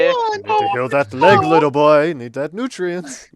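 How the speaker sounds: high loud doting parent; fussy parent voice. Retold or imitated. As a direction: scold the boy like a fussy mother. Parent voicing to little boy.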